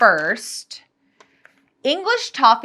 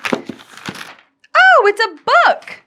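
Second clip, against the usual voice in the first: much higher, louder thrilled exclaim; delighted surprise; 8 dB louder, much slower than usual. Real, not acted. Opening a book gift for viewers, delighted surprise. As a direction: gasp of joy at the find.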